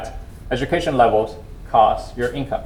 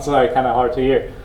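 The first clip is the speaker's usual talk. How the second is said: ordinary delivery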